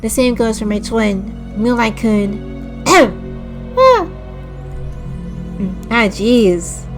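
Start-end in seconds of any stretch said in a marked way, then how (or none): none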